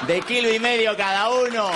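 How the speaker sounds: high, loud comic declaration; bellowed proclamation. Pretend. Comic announcing his last wish for suppositories, bellowing. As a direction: bellow it out to the crowd.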